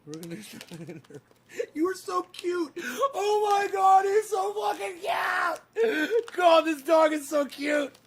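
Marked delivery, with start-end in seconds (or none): 1.7-7.9 high, loud squeal of delight; squealing over dog. Real, not acted. Owner squealing at his cute dog, very high and loud.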